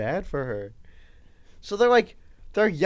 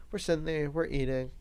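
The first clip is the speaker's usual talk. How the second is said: ordinary delivery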